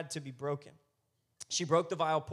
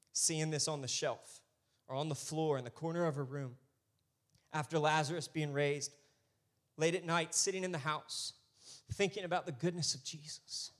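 usual speaking voice

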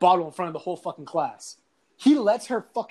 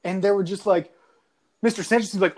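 ordinary talk

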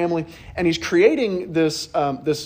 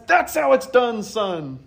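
proud encouragement; 7 semitones higher, slower than usual. Retold or imitated. Relative praises Lewis.

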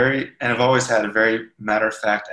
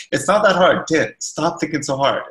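higher, louder exasperated shrug-off; impatient brush-off; 5 semitones higher, 5 dB louder than usual. Retold or imitated. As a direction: brush him off and tell him to quit overthinking. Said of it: "You were definitely like".

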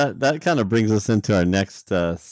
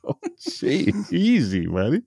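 mock calming; 8 semitones higher, slower than usual. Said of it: "It's just like".

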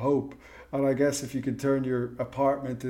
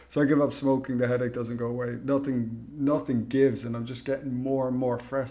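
ordinary talk

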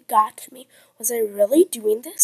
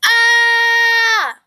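high, loud, sustained "ah" scream; acted scream; 9 semitones higher, 5 dB louder than usual. Zipliner screaming on the way down, high held scream. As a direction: scream it out on one long high note. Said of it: "scream at the top of my lungs".

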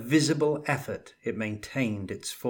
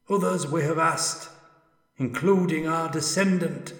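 high, loud, otherworldly ghost; an anguished spirit calling from beyond; ghostly spirit voice; 6 semitones higher, 4 dB louder than usual. Acted. Ghost praises the dwarf.

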